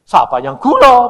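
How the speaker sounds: loud, high rebuttal; God's retort. Retold or imitated. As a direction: fire back as an affronted God. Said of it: "Tuhan bilang".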